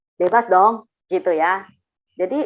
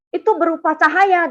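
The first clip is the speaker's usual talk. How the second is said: mildly irritated retold correction, higher pitched; angry correction; 6 semitones higher than usual. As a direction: keep scolding, with the anger easing. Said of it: "dia marah saya".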